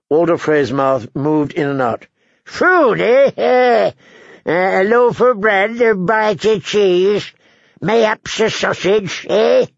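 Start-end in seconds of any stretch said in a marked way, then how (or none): 2.4-9.7 put-on high-pitched old man voice with querulous "eh?"; crotchety old lord. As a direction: offer food like a cranky old host.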